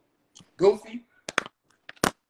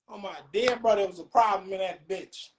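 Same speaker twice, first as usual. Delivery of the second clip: ordinary delivery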